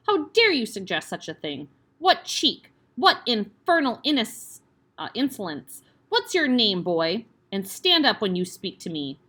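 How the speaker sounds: furious headmistress tirade. Acted. Headmistress berates the cheeky boy, loud and furious. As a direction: tear into the boy.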